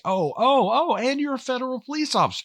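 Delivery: high, gushing fake-friendly welcome; mock fawning barman. Mocking the bar owner welcoming the federal cop, high and gushing. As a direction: ham up a syrupy host greeting.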